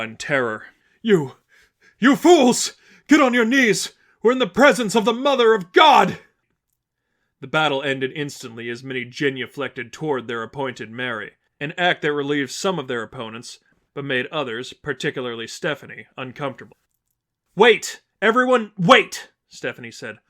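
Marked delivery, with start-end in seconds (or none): 1.0-6.2 high, loud frantic cry at the fools; awestruck shout. Acted. Preacher shouting at his followers to kneel, awestruck. 17.6-19.3 high, loud call to halt everyone. Acted. Stephanie stopping the kneeling crowd, loud urgent call.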